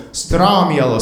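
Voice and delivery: usual speaking voice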